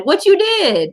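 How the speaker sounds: imitated small-town Southern accent, drawn up high; exaggerated Southern twang, high on the last word; picked-up hometown accent. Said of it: "I picked up the accent".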